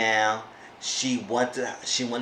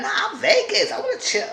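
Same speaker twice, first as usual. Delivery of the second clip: put-on giggly party-girl voice.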